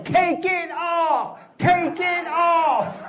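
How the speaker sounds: women in the audience chanting at the comic; mock crowd yelling. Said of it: "They just kept yelling".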